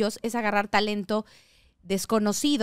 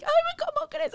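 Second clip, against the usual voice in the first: mother's disbelief; slower than usual. Retold or imitated. Mom reacts to big news.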